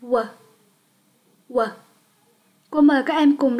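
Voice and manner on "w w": the letter sound "W" said short, lips rounded. Shown as a demonstration. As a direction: model the "W" sound for repeating. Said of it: "âm này chúng ta đọc là W". (phát âm âm "W" ngắn, tròn môi)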